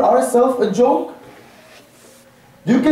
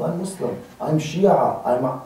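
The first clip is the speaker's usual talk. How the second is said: hesitant self-introduction; 8 semitones lower, 6 dB quieter than usual. Acted. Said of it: "Us, we have to tell people".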